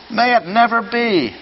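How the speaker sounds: Paul's outcry. Retold or imitated. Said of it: "He says it's irreverent".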